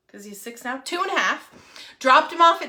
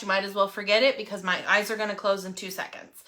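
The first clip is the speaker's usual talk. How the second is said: ordinary talk, nothing marked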